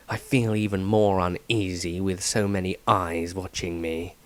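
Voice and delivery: low, quiet, guarded voice; Dieter's wary entry. Acted. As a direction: murmur it as a watchful man who trusts no one. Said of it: "Extract from the Diary of Dieter".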